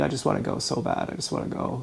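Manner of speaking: very soft, low, voice dropping away; retold despair, sinking almost to a whisper; inconsolable. Recalling wanting to leave the phone call, inconsolable and hushed. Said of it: "I was inconsolable".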